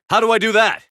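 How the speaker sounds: high, loud urgent ask; frantic combat shout; shouted question. Acted. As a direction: yell the question over chaos. Shouting question during battle.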